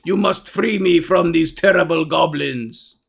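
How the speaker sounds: slow, deliberate ogre plea; pleading ogre. Acted. Caged ogre begs the adventurer for rescue, pleading. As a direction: plead ponderously, word by word.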